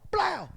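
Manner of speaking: high, loud burst for a door hit; imitated bang. Storyteller acting out catching the door, sudden loud bang. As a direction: punch out the noise of catching a door.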